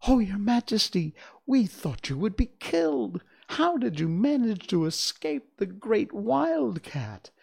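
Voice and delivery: high, loud, anxious little mice voices; excited mice. Acted. Mice rushing to their queen, high excited exclamation. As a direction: gasp in relief at your queen. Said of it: "they exclaimed".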